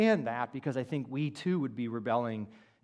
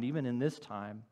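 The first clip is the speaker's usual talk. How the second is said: ordinary talk, nothing marked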